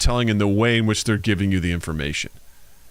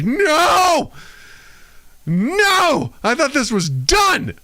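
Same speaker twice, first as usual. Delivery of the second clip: mock despairing wail; much slower than usual. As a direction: wail like the show betrayed you. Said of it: "And I was like".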